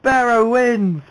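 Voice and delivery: loud, slow, high call of the winner; winner announcement. Real, not acted.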